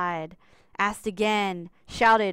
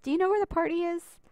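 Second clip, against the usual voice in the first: asked; 8 semitones higher, faster than usual. A narrator's reading. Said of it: "Sandra asked".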